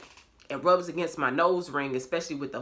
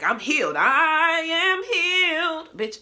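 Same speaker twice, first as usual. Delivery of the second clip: drawn-out high declaration, very slow and sassy; mock proclamation; 13 semitones higher, 6 dB louder, slower than usual. Mocking her own belief that she was healed, drawn-out proclamation. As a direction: proclaim it like a triumphant diva. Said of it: "I'm like".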